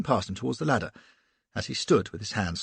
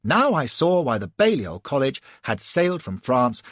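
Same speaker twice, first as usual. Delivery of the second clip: usual speaking voice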